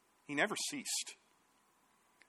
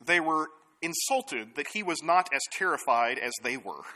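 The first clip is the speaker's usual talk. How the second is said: ordinary delivery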